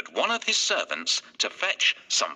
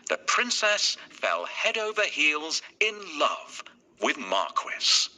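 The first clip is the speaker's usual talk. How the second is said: ordinary delivery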